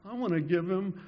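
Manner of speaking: put-on girl pleading through tears; retold sob. A girl sobbing as she offers her horse, mildly tearful. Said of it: "this little girl just started sobbing and said".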